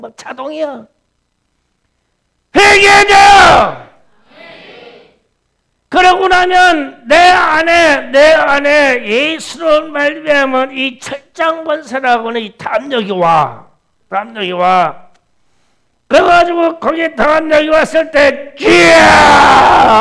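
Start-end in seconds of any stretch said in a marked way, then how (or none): 2.5-3.9 slow, drawn-out shout; shouted call. Real, not acted. Preacher calls congregation to repent. 18.6-20.0 long, drawn-out loud cry; crying out Lord. Shown as a demonstration. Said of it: "하고 외치는 거예요".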